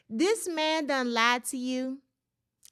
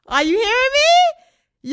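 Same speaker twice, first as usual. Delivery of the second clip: high, loud, slow "hearing me"; stretched-out outraged cry; exasperated shout; 12 semitones higher, 13 dB louder, slower than usual. Real, not acted. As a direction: yell it up high in disbelief. Exasperated plea to viewers.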